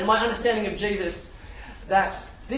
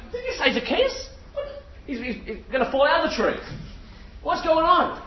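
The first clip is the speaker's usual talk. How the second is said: startled; 6 semitones higher than usual. Acted. Startled Zacchaeus clinging in tree.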